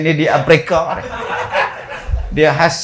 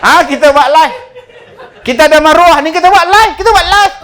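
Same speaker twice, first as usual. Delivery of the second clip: high, loud and rising shrill threats; shrieking mother; 12 semitones higher, 5 dB louder, faster than usual. Acted. Outraged mother screaming at the teacher that she will livestream. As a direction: escalate into a hysterical threat.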